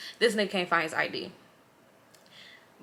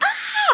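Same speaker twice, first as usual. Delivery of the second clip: high-pitched scream; short acted shriek; retold scream; 4 dB louder than usual. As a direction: let out a high, alarmed scream. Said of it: "I'm screaming".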